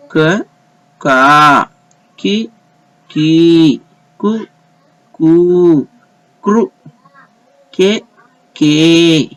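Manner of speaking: slow recitation with long vowels held and lifted; कू and के drawn out, कु and कृ kept short; syllable drill. Shown as a demonstration. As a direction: recite each syllable like a chart for learners. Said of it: "फिर से एक बार".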